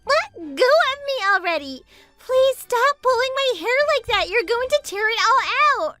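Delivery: high-pitched, whiny begging; high, distressed protest; pained pleading. Acted. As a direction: plead as if your hair is being torn. Begging as hair is pulled.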